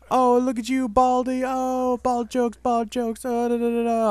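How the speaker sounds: high, mocking schoolyard taunt; sing-song the bully's teasing, way up high; nasty playground kid jeering, pitched high. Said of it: "he says".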